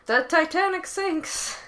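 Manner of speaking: hammed-up critic headline, slow and high; mock headline pun. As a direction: read the pun like a smug critic, slowly. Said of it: "Every movie reviewer writing trite puns".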